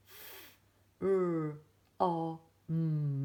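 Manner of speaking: sounding out f-r-o-m as separate sounds; each letter's sound, not its name. Shown as a demonstration. Teacher sounding out letters slowly for the class. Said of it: "Make sure you say the sound".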